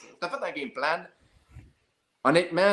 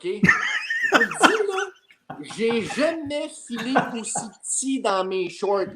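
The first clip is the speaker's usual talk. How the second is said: laughing disbelief; 6 semitones higher, 4 dB louder, slower than usual. Real, not acted. Reliving Nadal charging at him.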